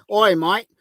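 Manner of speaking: hammed-up Aussie cop saying "mate"; lightly done Australian accent; mock Australian accent. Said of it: "come to my goddamn door and say".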